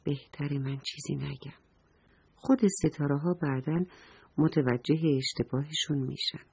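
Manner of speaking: soft, low inner voice; quiet storybook musing; thinking to oneself. A narrator's reading. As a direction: say it softly, as a private thought. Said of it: "با خودش فکر کرد".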